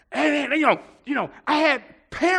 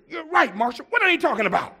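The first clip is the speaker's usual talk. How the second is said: higher-pitched, indignant agreement; retold indignation; 7 semitones higher, faster than usual. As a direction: act out feeling vindicated against the critics. Acting out his indignant agreement.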